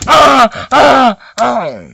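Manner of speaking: loud, high repeated "ah" yells; mock fight screams; acted battle cries. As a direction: cry out in bursts.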